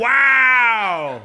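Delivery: sudden loud, high cry of surprise; a real shout of amazement; surprised shout. Real, not acted. Surprised outburst at the table.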